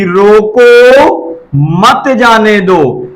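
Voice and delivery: रोको, long pause, then मत जाने दो together; slow reading, break straight after रोको. Shown as a demonstration. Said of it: "अब यहाँ पे हम पढ़ेंगे".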